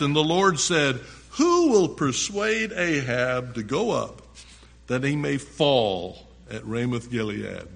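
emphatic, projected Bible reading; preacher reading scripture to the congregation. A narrator's reading. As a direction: read it out loud, punching key words. Reads scripture aloud, forcefully.

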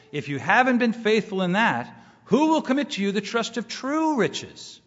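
scripture reading. Acted. Reads scripture aloud.

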